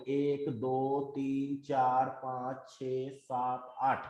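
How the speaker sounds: slow, low, soft count with spaces between numbers; counting one by one. Real, not acted. Teacher tallying touches on the chart, slow quiet counting. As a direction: count quietly, letting each number stand alone.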